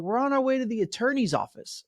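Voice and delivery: lightly voiced client; [name]'s voice.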